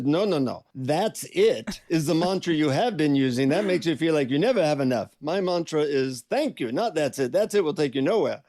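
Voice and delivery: mildly stern correction in an imitated Indian accent; stern Indian guru. Guru corrects the disappointed seeker, stern Indian accent. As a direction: scold lightly as an Indian-accented teacher. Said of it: "he looks at me very sternly".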